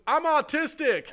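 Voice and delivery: high, slow reply; high slow voice of the girl; girl's reply. Retold or imitated. Retold girl's answer.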